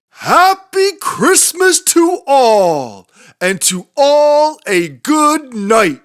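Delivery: high, loud and slow, ringing out; joyful exclamation. A narrator's reading. Saint Nick exclaims to all as he drives off, high ringing call. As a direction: call a big farewell as you drive away. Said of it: "But I heard him exclaim".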